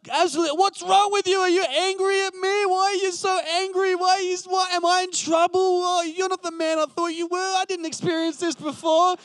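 wife in tears asking why he's so angry, mock sobbing; mock tearful wife. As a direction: play his wife pleading through tears. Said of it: "she starts bursting into tears".

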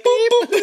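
high beeping boops; metal detector beeps. Retold or imitated. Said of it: "it sounds like a metal detector".

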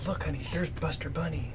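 soft hushed whisper; whispering to daughter. Retold or imitated. Dad whispers to his little daughter in the autograph line, hushed. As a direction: lean in and whisper so only she hears.

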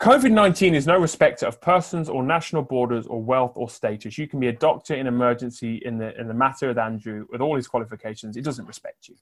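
usual speaking voice

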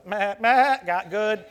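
goat bleating. Retold or imitated. Preacher answering his sister after running over his wife, goat bleat. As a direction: bleat it like a goat boy who can't talk. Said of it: "I was doing the goat boy".

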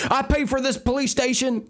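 high, outraged citizen; indignant squeaky retort. Pretend.

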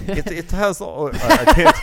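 breaking up laughing, high; can't get words out for laughing. Real, not acted. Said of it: "I love it".

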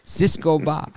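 imitating the kebab seller with a Spanish accent; heavy Spanish accent. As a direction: hawk "Cisco ba" like a Spanish-accented street seller. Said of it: "but with a heavy Spanish accent".

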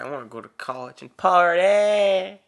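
eager. Real, not acted. Eager about partying.

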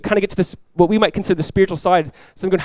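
ordinary talk

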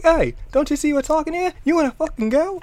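hammed-up picking a fight; mock thug challenge. Gamer voicing grunts ready to fight Hop, high mock challenge. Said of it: "and they're like".

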